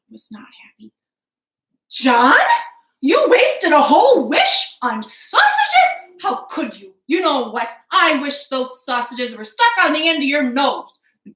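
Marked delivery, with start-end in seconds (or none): 1.9-11.0 annoyed wife. Acted. Angry wife scolds.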